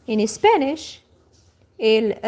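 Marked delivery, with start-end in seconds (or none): none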